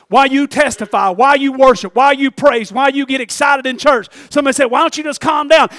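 mounting revival cadence; building preaching. Real, not acted.

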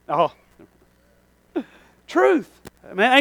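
normal talking voice

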